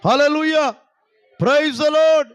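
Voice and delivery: rally the congregation to shout praise; loud praise shout. Real, not acted. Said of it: "గట్టిగా చెప్తాం అందరూ కలిసి". (గట్టిగా హల్లెలూయా అరుపు)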